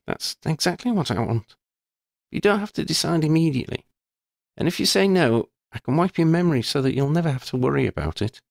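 put-on girl's voice, amused then serious; Lydia, wry schoolgirl. A narrator's reading. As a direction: tease her friend, then own up frankly. Lydia reassures Shona.

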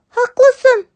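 sweet kitten reassuring her father. Acted. Kitten agrees. (yavru kedi sesi, istekli)

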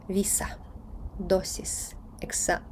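Віса, досіс, екса- softly, with a hissing s; voiceless s. Shown as a demonstration. Teacher warning against z, soft Віса, досіс, екса- with pure s. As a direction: keep every s in Віса, досіс, екса- free of z. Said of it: "Не треба вносити в іспанську мову звук".

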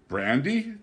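mock surprise at the brandy; cried in surprise. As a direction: act amazed at the news. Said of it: "Danny cried".

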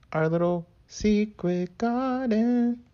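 high, slow, drawn-out flourish; playful put-on voice. Crafter showing off the finished paper garden, playful put-on voice. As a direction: present it in a silly high voice.

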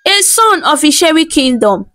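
ordinary delivery, nothing marked

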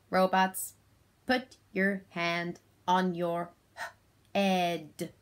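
monotone robot, slow separated words. Shown as a demonstration. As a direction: talk like a robot, sounding out the last word. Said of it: "Did you spot my robot talk?".

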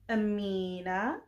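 ordinary talk

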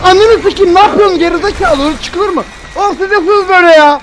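loud, high frustrated yelling; angry yelling. Real, not acted. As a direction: rant loudly at the game. Angry at game after death.

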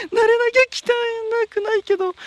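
slow, high, loud laughing words; high, slow words full of laughter; laughing through words. Real, not acted. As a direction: talk while still giggling. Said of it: "笑ってるようにしか聞こえない".

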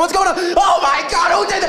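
high-pitched hysterical crowd voices; mock panic. Adults running over to the scene, high mock hysteria. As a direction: imitate horrified adults shrieking questions.

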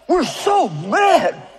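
mock mad. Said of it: "Do I look mad?".

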